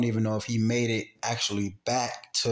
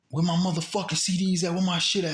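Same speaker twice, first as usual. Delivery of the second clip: raised-pitch retold demand; mock angry demand, higher; gunman's demand; 7 semitones higher, faster than usual. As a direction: imitate an armed robber demanding his stuff.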